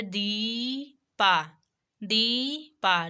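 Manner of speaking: दीपा very slow, with a long-held "deee"; exaggerated teaching demo of long ee in दीपा; long vowel. Shown as a demonstration. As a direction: stretch the "ee", then drop onto "pa" in दीपा. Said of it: "long sound based है".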